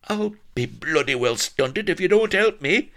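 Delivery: high, loud indignant outburst; crying out. A narrator's reading. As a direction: yell it in exasperation at your helper. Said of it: "cried Blood".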